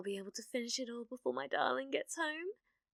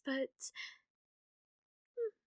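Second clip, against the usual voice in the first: normal talking voice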